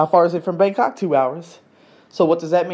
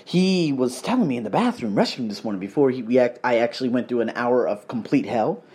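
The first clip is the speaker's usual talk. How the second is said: ordinary talk, nothing marked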